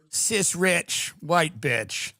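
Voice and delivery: drawn-out, each word savored; slow and deliberate. Pretend. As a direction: spell out the label slowly. Slow full label delivery.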